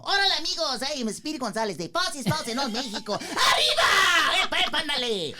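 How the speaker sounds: high, loud, excited cartoon Mexican mouse voice; full-blast, high-pitched cartoon cheer with a Mexican accent; Speedy Gonzalez. Shown as a demonstration. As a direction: shout it out like a hyper cartoon mouse, pitch soaring. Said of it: "you guys wanna hear it?".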